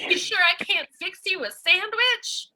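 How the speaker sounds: put-on high, bright, slowed sitcom kid; sarcastic golly-gee, Leave It to Beaver; wholesome 1950s kid. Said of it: "pretend you're happy to see me".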